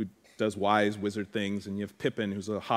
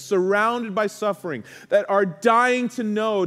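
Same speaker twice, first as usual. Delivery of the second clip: higher and louder, preaching with rising fervour; impassioned preacher pleading with the congregation, higher and louder; full-force preaching; 10 semitones higher, 7 dB louder than usual. Acted. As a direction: build the sermon to a passionate climax.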